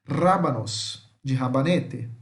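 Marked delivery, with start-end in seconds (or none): 0.0-1.1 slow "Rábanos", stressed on RÁ; "Rábanos" drawn out with a trilled R and strong start; stress on accented syllable. Shown as a demonstration. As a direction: punch the first syllable of "Rábanos". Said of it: "onde vamos falar a sílaba mais forte".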